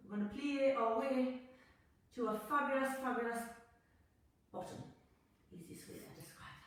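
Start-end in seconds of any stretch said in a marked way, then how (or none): 4.5-6.5 unvoiced, breathy aside; whispered aside. Real, not acted. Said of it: "aside about bottom".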